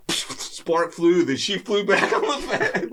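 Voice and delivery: high-pitched, drawn out through laughter; laughing while telling. Real, not acted. Storyteller reaching the wife's tumble onto the fan, laughing hard. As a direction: crack up as you tell it.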